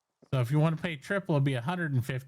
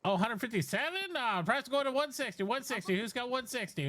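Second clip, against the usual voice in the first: fast auction patter calling bids; auctioneer; 6 semitones higher, 3 dB quieter than usual. Acted. S0 runs scooter auction.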